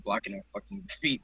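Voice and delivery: normal talking voice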